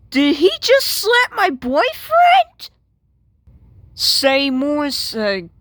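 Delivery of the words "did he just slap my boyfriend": much higher and louder, appalled; shocked outrage. Acted. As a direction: cry out in disbelief. Sees boyfriend get slapped.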